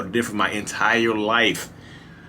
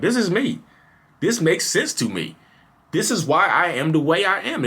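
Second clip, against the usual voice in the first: thrilled discovery, pitched way up; eureka realization; 10 semitones higher, 3 dB louder than usual. Retold or imitated. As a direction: blurt it out like everything finally clicks. Self-diagnosis, an eureka moment.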